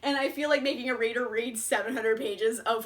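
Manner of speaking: ordinary talk, nothing marked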